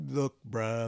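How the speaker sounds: ordinary talk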